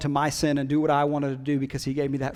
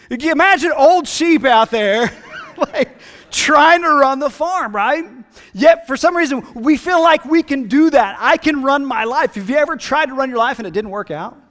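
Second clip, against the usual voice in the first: rising, louder and higher preacher's push; fervent preacher growing to a loud, high call; preaching at full force; 11 semitones higher, 12 dB louder than usual. Acted. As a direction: build each line like a pulpit climax.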